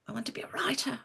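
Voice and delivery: whispered, timid and small. Retold or imitated. To the career counsellor, admitting her dream in a tiny whisper. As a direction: barely dare to say it aloud, in a whisper. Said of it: "in a very small voice".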